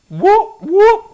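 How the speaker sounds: high loud siren whoop; police siren imitation. Shown as a demonstration. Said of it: "We are sending the police".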